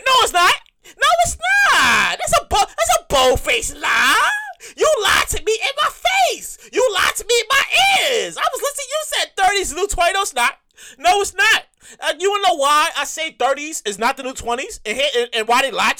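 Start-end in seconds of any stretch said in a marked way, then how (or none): 0.0-4.3 high, slow, loud denial; indignant shout. Acted. Comic yelling at whoever called thirty the new twenty, loud and high. Denying the old saying. 4.7-11.6 mock outraged shout. Comic accusing the people who lied about thirty, high outraged shout. Ranting at the liars.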